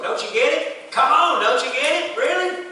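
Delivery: mock disbelief building to a high Really; teasing needling. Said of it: "And they just keep on".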